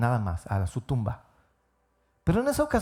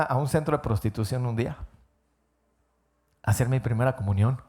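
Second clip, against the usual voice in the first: ordinary delivery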